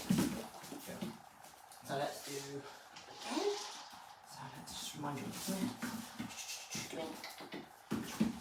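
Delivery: very soft, low murmur; hushed, low aside to a pet; quiet coaxing. Real, not acted. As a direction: murmur to the dog as you reset. Murmuring while resetting the dog.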